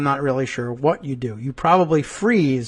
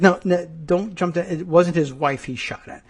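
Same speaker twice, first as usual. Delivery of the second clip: usual speaking voice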